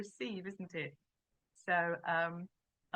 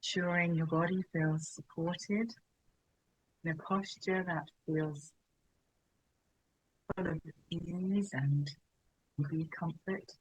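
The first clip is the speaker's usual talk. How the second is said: unhurried, even, spacious delivery; guided meditation; 6 dB louder than usual. Real, not acted. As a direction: guide them gently into settling, phrase by phrase. Leads a settling meditation.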